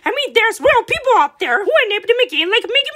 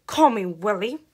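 resigned sigh; 10 semitones lower, 8 dB quieter than usual. Acted. Defeated after losing his name.

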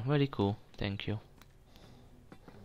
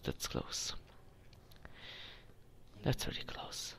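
whispering; 7 semitones lower, 18 dB quieter than usual. Real, not acted. Sneaking past guards in game.

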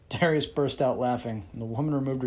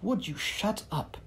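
higher, slow, British woman voice; barked; 7 semitones higher, 4 dB quieter, slower than usual. Acted. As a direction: Brynn barks at Darius, lightly voiced. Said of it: "the temptress barked at him".

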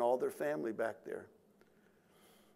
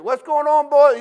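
concerned grandpa; 19 dB louder than usual. Retold or imitated. Blind grandpa asks startled kids.

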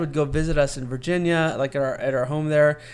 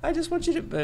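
mock teary voice pitched much higher; imitating crying mom. Mom crying at lunch, imitated teary and much higher. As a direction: squeeze it out high like holding back tears. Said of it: "she starts just crying".

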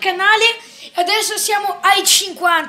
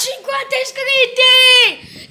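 excited shout; 8 semitones higher, 3 dB louder, slower than usual. Real, not acted. Celebrating fifty subscribers.

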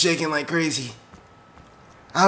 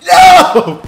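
high, loud cursing yell; anguished shout; 13 semitones higher, 11 dB louder than usual. Real, not acted. Player killed again with shock coming, anguished shout. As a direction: yell in dismay at dying.

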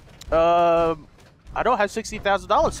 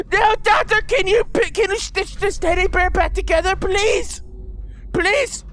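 high-pitched, hammed-up begging; desperate pleading; 10 semitones higher than usual. Player begging the doctor to stitch a teddy, desperate pleading. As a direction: beg as if a life depends on it.

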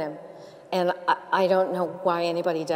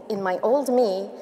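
ordinary talk

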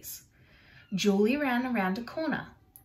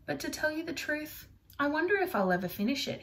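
sad; 6 semitones higher, faster than usual. Acted. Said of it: "Monet looked sad".

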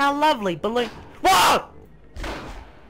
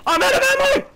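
much higher, loud panicked yell; panicked shout; 8 semitones higher, 4 dB louder, much faster than usual. Real, not acted. Panicked mid-fight in game.

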